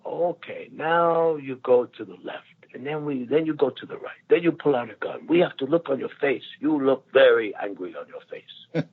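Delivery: a fond imitation of a Hong Kong director's coaching; imitating the director. Mimicking Hong Kong director Ringo Lam coaching the actor. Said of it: "I used to do imitations of the director".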